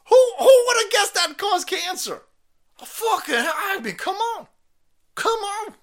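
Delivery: high, loud sarcastic wail; mock-outraged disbelief. Host mocking the article, wailing in mock disbelief.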